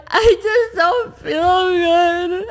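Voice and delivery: high, drawn-out tearful wail, imitated; mock sobbing. Wife re-enacts her laugh turning into tears, mock sobbing. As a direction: re-enact a slow, whiny crying complaint. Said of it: "the laugh turns into sobs of, like, tears".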